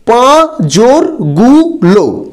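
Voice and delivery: পাঁজরগুলো split slowly into পাঁ-জর-গু-লো; syllable by syllable. Shown as a demonstration. Teacher splitting a word into syllables for students. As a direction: say পাঁজরগুলো one syllable at a time. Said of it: "এখানে জোর হলো রুদ্ধ দল".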